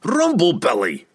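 high-pitched exasperated dwarf cry; flustered shout. A narrator's reading. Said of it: "shouted her flustered father".

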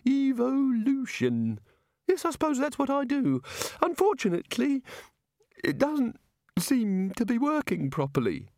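high, airy voice of a muddled god; a dreamy, absent-minded deity explaining creation; vague god voice. A narrator's reading. Narrator voices the god explaining evolution to wizards, high and airy.

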